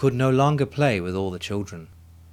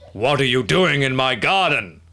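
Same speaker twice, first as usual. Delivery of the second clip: big booming giant voice, louder; giant's roar; 3 semitones higher, 5 dB louder than usual. A narrator's reading. Angry giant roaring at children in his garden. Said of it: "it roared".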